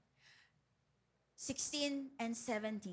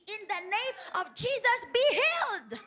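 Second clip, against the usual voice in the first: loud, higher authoritative command; 7 semitones higher, 7 dB louder than usual. Shown as a demonstration. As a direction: order the sickness out with authority. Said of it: "Hindi tayo nakikiusap".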